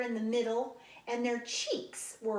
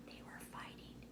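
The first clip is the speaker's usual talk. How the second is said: conspiratorial whispered aside; 20 dB quieter than usual. A narrator's reading. Reader confiding to children, hushed whisper. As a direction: lean in and whisper it. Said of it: "unvoiced whisper".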